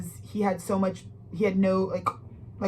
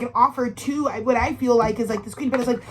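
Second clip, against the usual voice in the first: ordinary talk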